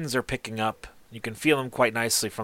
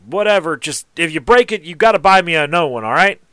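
higher and louder, a blunt tradesman; gruff warning; 6 semitones higher, 8 dB louder than usual. Acted. Artisan warning the stranger about breaking his ladder, louder. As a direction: warn him off with a raised voice.

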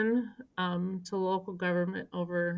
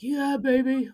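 high, loud cheer of approval; cheering colleague; 6 semitones higher, 6 dB louder, slower than usual. Retold or imitated. Colleague Decker cheering her on from the crowd, high and loud. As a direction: egg her on like a proud buddy. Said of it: "like he always does".

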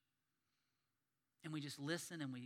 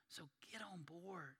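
whispered plea, softer and slow; urgent whisper; 9 dB quieter, slower than usual. Real, not acted. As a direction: whisper it like a personal appeal. Pastor urging the congregation.

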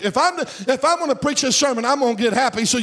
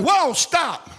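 retold scream; 6 semitones higher than usual. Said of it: "He screamed in the car".

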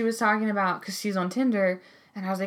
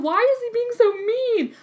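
tearful whine; 14 semitones higher, 4 dB louder than usual. Retold or imitated. Tearful inner voice.

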